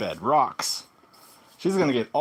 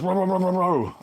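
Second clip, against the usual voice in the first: mock barking, higher and louder; imitated dog bark; 6 semitones higher, 3 dB louder than usual. A dog rushing in, imitated barking. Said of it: "I hear this".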